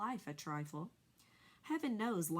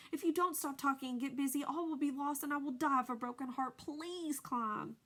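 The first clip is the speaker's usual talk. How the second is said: higher, louder pleading; desperate plea; 7 semitones higher, 3 dB louder than usual. A narrator's reading. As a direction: beg him urgently. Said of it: "said Wilbur in desperation".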